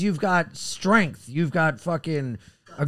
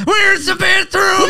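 high, loud, drawn-out impression; hammed-up high contestant voice; mock dating contestant; 13 semitones higher, 6 dB louder, slower than usual. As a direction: call it out slowly in a high put-on voice. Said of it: "get up and go".

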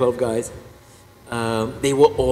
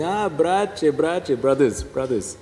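imitated Croatian priest, higher at first; Croatian accent; 4 semitones higher, much slower than usual. Pastor hailing his fellow Croatians, in a Croatian accent. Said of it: "I have to do the accent".

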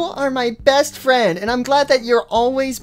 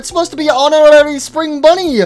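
high, loud complaining cartoon bunny; a squeaky bunny yelling about a lost quarter; high whiny bunny voice; 6 semitones higher, 3 dB louder than usual. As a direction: whine like a cheated toy rabbit. Voices Spring Bunny at vending machine.

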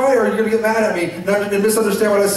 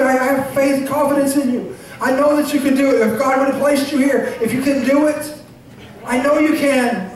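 ordinary talk

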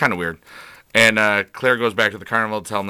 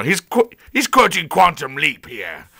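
a loose, put-on English accent, pitched higher; half-hearted English accent, tossed off as a joke; bad David Anders English accent; 6 semitones higher than usual. Vlogger mimicking the actor in a bad English accent. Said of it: "that's my bad David Anders accent".

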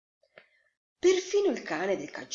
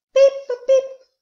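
high, loud chick peeps; ducklings peeping; 15 dB louder than usual. A narrator's reading. Newly hatched ducklings greet the world, peeping. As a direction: peep shrilly like hatchlings. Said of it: "facevano gli anatroccoli".

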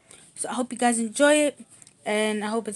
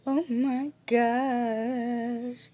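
a long, slow, drawn-out gasp of joy; gushing; much slower than usual. Real, not acted. Gushing over the new engagement ring, slow and awestruck.

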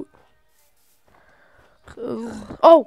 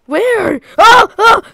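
much higher and louder frantic screaming; panicked yelling; 10 semitones higher, 13 dB louder than usual. Real, not acted. Player attacked at night, screaming at his brother and dog. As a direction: scream as mobs rush him.

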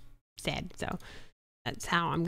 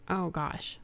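ordinary talk

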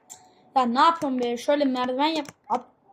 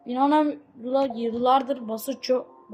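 normal talking voice

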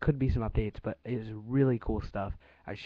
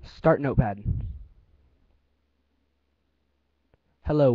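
news anchor voice. Real, not acted. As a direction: enunciate every word like a newsreader. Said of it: "use your news anchor voice".

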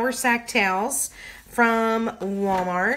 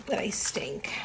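whispered aside; 2 semitones lower, 8 dB quieter than usual. Real, not acted. Self-deprecating aside while forgetting iPad.

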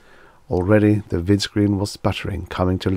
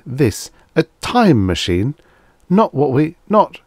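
rising from stunned to frantic; 8 semitones higher, 5 dB louder than usual. A narrator's reading. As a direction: lose composure, climbing high. Bassett backs away stunned.

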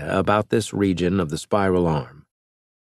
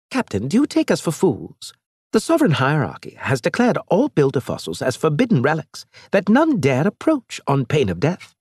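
higher-pitched alien envoy, scornful; lightly raised alien voice; alien Elge; 8 semitones higher than usual. Acted. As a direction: play the proud alien mocking the captain.

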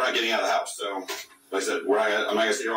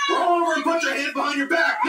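shouting. Real, not acted. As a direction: scream the command at her. Officer repeats command, shouting.

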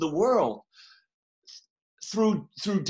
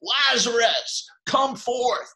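slow, raised call to Lazarus; a preacher voicing Jesus crying out; 5 semitones higher, much slower than usual. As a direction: call him out of the tomb, stretched and lifted. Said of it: "He cried".

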